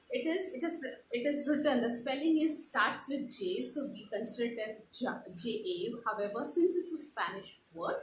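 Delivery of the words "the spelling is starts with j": ordinary talk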